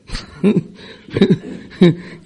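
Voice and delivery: long unvoiced laugh, falling away; breathy laugh. Real, not acted. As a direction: laugh at her own past folly. Risa tras recordar el poema. (risa larga y soplada, real)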